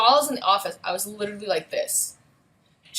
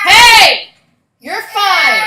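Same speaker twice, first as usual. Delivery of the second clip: sudden high loud call, then reassuring; calling out to son; 11 semitones higher, 17 dB louder, much slower than usual. Real, not acted. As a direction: mom calling to a fussing son off-mic.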